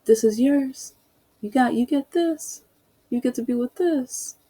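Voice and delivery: imitating a scornful officer; mocking cop. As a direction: sneer at her in a put-on voice.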